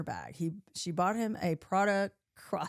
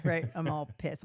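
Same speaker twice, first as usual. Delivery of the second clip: ordinary talk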